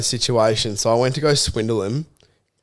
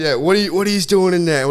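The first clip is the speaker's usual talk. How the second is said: higher-pitched mock Australian guard; put-on Australian accent, raised in pitch; thick Australian accent; 6 semitones higher than usual. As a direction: imitate a guard in broad Aussie. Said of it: "In a thick Australian accent".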